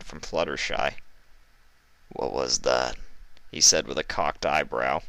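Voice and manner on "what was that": put-on low, whispered northern-accented thug; menacing northern heavy. Iceberg promising cruelty to the captives, low whispered menace. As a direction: purr it, low and sinister.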